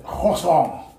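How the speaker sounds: slow, mock-French pronunciation; French accent. Shown as a demonstration. Friend offering a word for the French round, French accent. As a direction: draw it out in hammed-up French. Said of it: "Let's take it to French".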